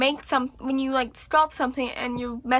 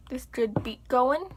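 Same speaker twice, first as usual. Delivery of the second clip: usual speaking voice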